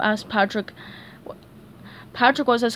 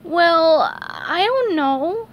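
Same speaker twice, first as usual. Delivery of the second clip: slow, unsure hesitation; hesitant; 7 semitones higher, 5 dB louder, much slower than usual. Acted. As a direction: drag it out, unsure. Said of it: "SpongeBob hesitated".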